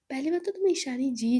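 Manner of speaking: usual speaking voice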